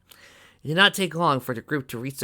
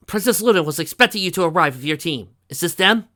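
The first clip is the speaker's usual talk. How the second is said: raised, loud official voice; bat pony guard, businesslike; 7 semitones higher, 9 dB louder than usual. A narrator's reading. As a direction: report loudly as a royal guard.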